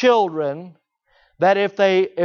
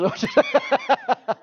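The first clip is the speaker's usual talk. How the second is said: high burst of laughter; high-pitched, amused laughing. Real, not acted. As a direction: laugh at your own joking aside. Joking aside about wedding cost.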